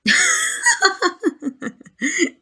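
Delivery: loud, high burst of laughter; mocking laugh. Vampire laughs at hunter's tiny weapon, mocking laughter.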